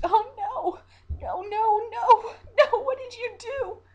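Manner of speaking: high, loud anguished cries of no, building to a wail; distraught. Acted. Siren finding the captain dead, anguished screaming. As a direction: scream your horror over his body.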